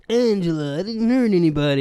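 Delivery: Tony Soprano impression. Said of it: "I wouldn't be like".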